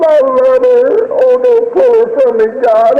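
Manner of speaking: high, sustained grieving plea; anguished prayer. Retold or imitated. Father praying for his dying child, anguished pleading. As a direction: beg God with a breaking heart.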